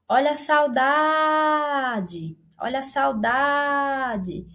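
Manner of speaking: "saudade" with a very long, high "da"; stressed penultimate syllable. Shown as a demonstration. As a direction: stretch "da" in "saudade" very slowly. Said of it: "Demoramos mais aqui na pronúncia".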